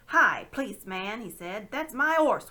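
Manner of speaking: loud, high protesting call; indignant shout. Acted. Red-faced cabman shouts to the policeman, indignant. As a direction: shove forward and claim it. Cabman claims his horse.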